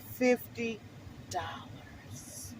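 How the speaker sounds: hushed awed whisper. Real, not acted. Telling of the gift check in a whispered payoff. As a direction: breathe the last word in wonder.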